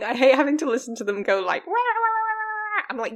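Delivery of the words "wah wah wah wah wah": mocking blah blah. Mocking the solar salesmen's spiel.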